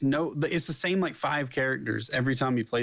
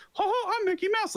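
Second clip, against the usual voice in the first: much higher squeaky cartoon mouse, laughing; Mickey Mouse voice. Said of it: "Do your Mickey Mouse voice".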